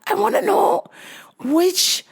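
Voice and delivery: high, broken-English imitation of the old mom. Old mom complaining to the clerk her hair dye never works, high mimicked voice. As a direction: mimic the mom asking for help. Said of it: "she said".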